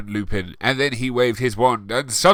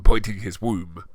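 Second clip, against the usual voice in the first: ordinary talk, nothing marked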